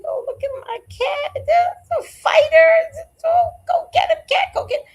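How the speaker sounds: high, loud squealing cheer; woman cheering her cat in a fight, high and loud. Retold or imitated. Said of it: "I was like".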